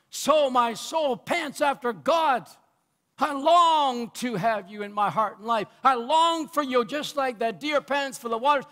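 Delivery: driving, fervent sermon delivery; impassioned pulpit cadence; full-force preaching. Real, not acted. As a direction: proclaim it to the congregation with longing.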